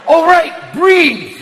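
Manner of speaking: rising from a hushed curse to a loud slow breathe; panicking stoner talking himself down; too stoned. Retold or imitated. Stoner panicking after one hit, hushed then loud. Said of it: "you hit it, it's like".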